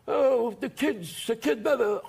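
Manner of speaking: slow, mock menacing ringside question; gangster voice. Mobsters at the ringside asking when he goes on, slow mock menace. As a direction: lightly play a tough guy at ringside. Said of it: "They're going".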